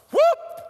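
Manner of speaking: high, loud whoop; sudden whoop. Retold or imitated. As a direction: let out a whoop as the temper breaks loose. His redneck temper surfaces.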